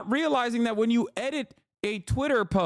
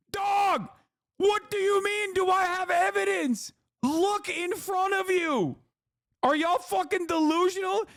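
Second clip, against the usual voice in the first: incredulous rant; 8 semitones higher than usual. Real, not acted. Streamer shouting at viewers who still want evidence, exasperated. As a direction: yell it like you can't believe they need proof.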